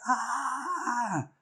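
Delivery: long high-pitched mock scream; retold scream, held high without much volume. Wife venting in the bathroom, high held scream. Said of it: "and just screamed".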